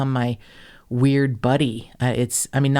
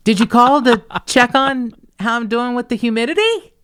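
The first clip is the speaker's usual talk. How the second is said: chipper joke; 11 semitones higher, 5 dB louder than usual. Retold or imitated. Cheerful joke to caller.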